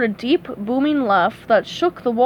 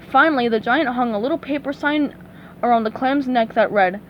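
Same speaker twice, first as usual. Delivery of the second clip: plain reading voice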